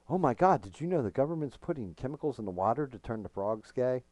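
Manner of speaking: lightly hammed-up panicked rant; mock conspiracy rant. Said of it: "Alex Jones syndrome".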